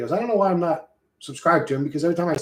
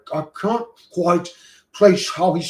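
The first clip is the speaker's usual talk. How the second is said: imitated hard-to-place New Zealand accent; Israel Adesanya; 3 semitones higher, 4 dB louder than usual. Streamer wondering how a New Zealander sounds, imitated Kiwi accent. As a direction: put on a puzzling Kiwi-like accent. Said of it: "what the fuck is he sounding like".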